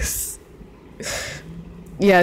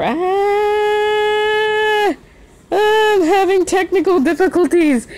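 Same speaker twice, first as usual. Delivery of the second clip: high, strained put-on voice, faking a meltdown; mock panicked yell; 14 semitones higher, much slower than usual. Streamer fakes a tech meltdown to chat, high mock panic. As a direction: squeal it like the stream is breaking. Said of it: "I'm just fucking yelling over and over".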